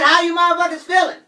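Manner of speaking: high, loud funk-frontman hype call. Retold or imitated. Frontman hyping the New York crowd, high loud shout. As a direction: whip the crowd up like a funk star. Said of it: "I was [name] yelling".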